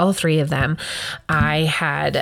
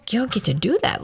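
normal talking voice

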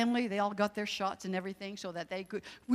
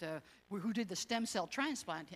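usual speaking voice

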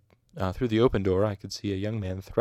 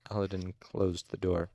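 ordinary delivery, nothing marked

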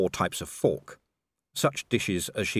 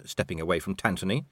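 usual speaking voice

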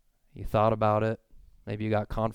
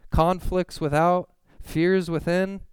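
higher, slow and emphatic; dramatic scripture reading; 7 semitones higher, 4 dB louder, slower than usual. Acted. Preacher proclaiming Paul's hardships, slow and high. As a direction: lean hard into each phrase.

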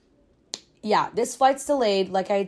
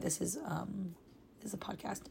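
whisper; 15 dB quieter than usual. Real, not acted. Whispered self-correction about podcast.

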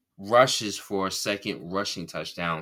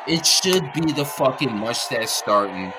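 angry outburst, louder and higher; louder angry repeat; 3 semitones higher, 8 dB louder than usual. Real, not acted. Fed up with the team owner, louder angry repeat. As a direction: hammer the point home, raised and heated. Ranting about the Indy quarterback.

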